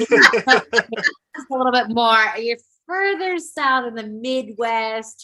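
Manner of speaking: slow, drawn-out transatlantic Saint Louis accent; lightly put-on Saint Louis accent. Shown as a demonstration. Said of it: "the transatlantic Saint Louis accent".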